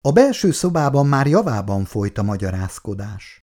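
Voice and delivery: plain reading voice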